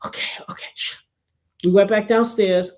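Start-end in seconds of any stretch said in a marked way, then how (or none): 0.1-0.7 whispered, retold giving-in; hushed whisper. Friend tapping her arm to hush her, whispered. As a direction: whisper it to quiet someone down.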